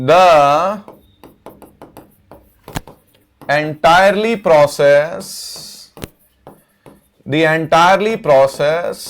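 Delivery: word groups drawn out with long pauses; measured, piece-by-piece sentence dictation; slow dictation. Real, not acted. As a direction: dictate slowly as you write on the board. Dictating a sentence while writing it.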